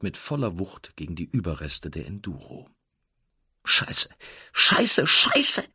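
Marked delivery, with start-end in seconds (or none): 3.7-5.6 sich steigernder Wutschrei, immer höher und lauter; Scheiße dreimal brüllen, jedes Mal höher. A narrator's reading. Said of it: "brüllte sie". (wütend brüllen, immer schriller)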